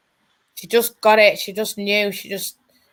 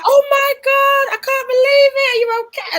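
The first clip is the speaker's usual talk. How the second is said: concerned. Retold or imitated. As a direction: imitate someone shocked and worried, voice way up high. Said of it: "oh, like, concerned".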